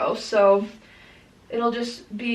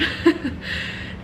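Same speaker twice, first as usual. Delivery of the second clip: breathy giggle; 5 semitones higher, 4 dB quieter than usual. Real, not acted. Laughs at her own resolve.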